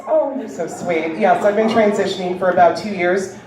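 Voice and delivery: ordinary delivery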